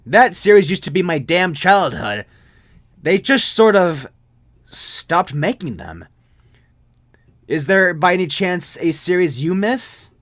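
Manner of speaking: put-on a friend mourning his childhood series; nostalgic friend. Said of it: "he replied".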